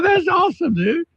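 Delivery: very high, gushing retold reaction; hammed-up thrilled admiration; delighted. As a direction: squeal approval at a pitch far up.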